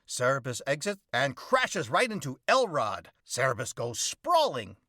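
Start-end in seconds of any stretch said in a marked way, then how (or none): none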